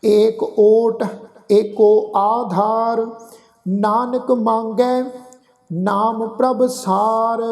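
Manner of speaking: slow, drawn-out chanted reading of the verse; measured Gurbani path recitation; slow scripture recitation. Shown as a demonstration. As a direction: recite each line slowly and reverently. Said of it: "ਇਸ ਢੰਗ ਨਾਲ ਪਾਠ ਉਚਾਰਨ ਨਹੀਂ ਕਰਨਾ".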